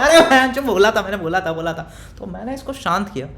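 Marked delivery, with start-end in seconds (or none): 0.0-1.9 loud, high gleeful burst that fades; excited, then trailing off. Retold or imitated. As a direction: crow about being right, then let it fade. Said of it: "ये तो खुश हो गया".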